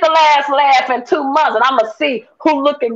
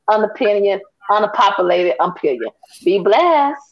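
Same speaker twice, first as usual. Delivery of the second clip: lower, polished promo voice for her company; business ad read; 5 semitones lower than usual. Acted. Guest plugs her travel business to the audience, smooth ad read. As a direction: switch into a smooth commercial pitch. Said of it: "That was funny how you switched like".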